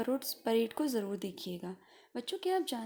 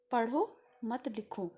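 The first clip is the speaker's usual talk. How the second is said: "पढ़ो", a clear pause, then "मत लिखो" together; pause after पढ़ो; slower than usual. Shown as a demonstration. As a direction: break after पढ़ो, run मत लिखो as one phrase. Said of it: "उसके बाद रुकना है". ("पढ़ो" के बाद रुककर "मत लिखो")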